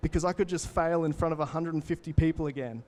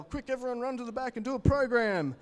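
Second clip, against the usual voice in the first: high, pushy salesman call; hammed-up rush-to-the-back pitch; mock American hard-sell voice; 7 semitones higher than usual.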